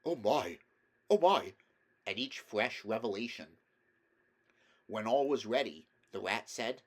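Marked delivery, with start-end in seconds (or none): 0.0-1.5 breathless, rising delight; gasping with delight. A narrator's reading. Mole gasping over the picnic packets, excited. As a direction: gasp it out at each new treasure. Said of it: "still gasping".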